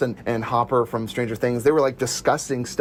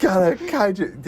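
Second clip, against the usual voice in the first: slow, higher deadpan echo; slow mock quote; 6 semitones higher, slower than usual. Echoing a friend's movie quote, slow and high. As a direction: savor the line slowly.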